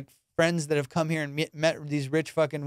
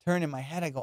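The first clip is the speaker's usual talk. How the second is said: ordinary delivery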